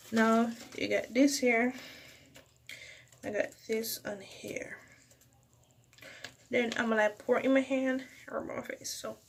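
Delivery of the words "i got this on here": whispering. Real, not acted. Aside to viewers.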